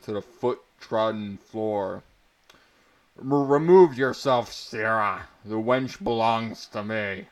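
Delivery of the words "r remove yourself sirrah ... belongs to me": a lightly voiced drunk soldier, louder; a touch of a slurring drunk, without overdoing it; drunken soldier. Drunk soldier challenging the barbarian for the wench, mild loud slur. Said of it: "blabbered a drunken soldier".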